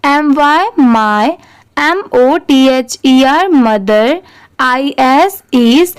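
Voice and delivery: each letter spelled out slowly, then the word; teacher spelling letter by letter for beginners; slow spelling drill. Shown as a demonstration. Teacher to beginners, spelling slowly letter by letter. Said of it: "तो चलिए start करते हैं".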